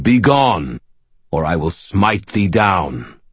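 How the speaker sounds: slow, firm resonant warning; a touch harder, commanding and slow; hardened deep voice. Acted. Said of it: "The deep voice hardened".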